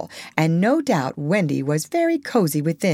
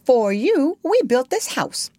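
plain reading voice

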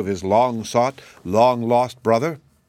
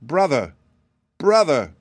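mild cry, higher on the repeat; narrated calling out, not a full shout; 5 semitones higher, 3 dB louder than usual. A narrator's reading. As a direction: call through a door, restrained. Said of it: "ran to the door and cried out".

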